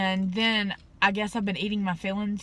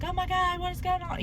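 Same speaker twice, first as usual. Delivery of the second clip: higher mock alarm of nurses; 9 semitones higher, 4 dB quieter than usual. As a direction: play the nurses bursting in startled. Nurses rushing in hearing screams.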